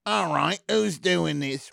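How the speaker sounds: high, put-on British accent; mock a celebrity's sudden British accent. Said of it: "[name] would suddenly be like".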